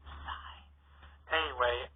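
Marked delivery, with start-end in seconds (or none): 0.1-0.6 deflated sigh. Acted. Groan at the pun.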